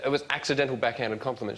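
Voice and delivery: usual speaking voice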